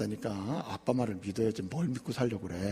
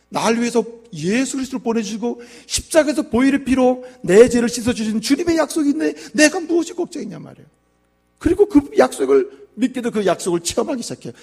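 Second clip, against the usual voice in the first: high, loud preaching surge; preaching at full force; 11 semitones higher, 10 dB louder than usual. Acted. Pastor rousing the congregation, loud preaching. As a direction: lift the congregation with a ringing cry.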